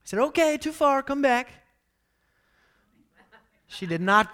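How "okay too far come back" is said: high call across the trail; raised voice calling a dog back. Retold or imitated. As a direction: call out to a runaway dog, higher.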